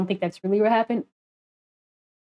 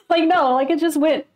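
laughing; 7 semitones higher than usual. Real, not acted. Laughing at sudden song.

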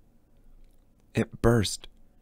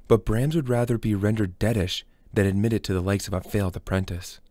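usual speaking voice

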